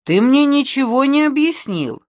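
high, loud acted complaint; indignant protest. A narrator's reading. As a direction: protest that you were left in the dark. Stubborn pupil protests to his friend.

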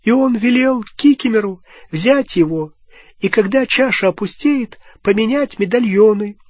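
put-on a sobbing old elf squeaking out his story; crying house-elf, pitched far up; weeping house-elf. A narrator's reading. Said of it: "Рыдания Кикимера обратились в подобие скрежета".

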